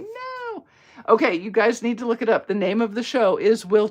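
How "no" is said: small, high hammed-up whimper; mock dismayed no. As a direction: squeak out a pretend heartbroken no. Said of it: "and I was like".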